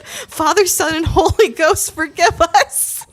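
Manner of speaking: put-on high, pleading drunk prayer; hammed-up plea, last words stretched; mock desperate prayer. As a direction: beg heaven for mercy, breaking into laughter. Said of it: "started praying".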